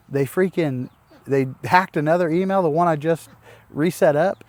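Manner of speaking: real exasperated rant. Real, not acted. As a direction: vent the disbelief. Exasperated telling.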